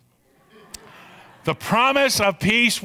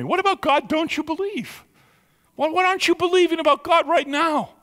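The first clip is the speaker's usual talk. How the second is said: friend's challenge; 9 semitones higher than usual. Retold or imitated. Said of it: "she goes".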